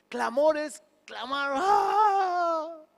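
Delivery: high, loud wailing cry; a shouted ¡ah! of clamor. Shown as a demonstration. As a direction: let out a real cry of pleading. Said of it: "a los gritos".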